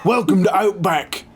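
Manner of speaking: put-on Aussie accent, high and hammy; a cheesy Australian restaurant ad voice. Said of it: "I can't do an Australian accent".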